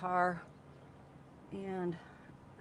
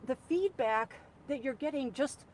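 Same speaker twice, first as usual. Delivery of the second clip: raised voice; 7 semitones higher, 5 dB louder than usual. Retold or imitated. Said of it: "I go".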